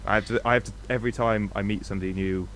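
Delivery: usual speaking voice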